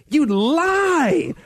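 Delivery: high, drawn-out accusation; hammed-up outrage; mock denunciation. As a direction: accuse with melodramatic outrage, stretching the last word.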